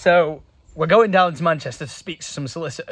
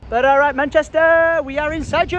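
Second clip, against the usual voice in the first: hammed-up announcement; 9 semitones higher, 4 dB louder than usual. Arriving in Manchester, hamming it.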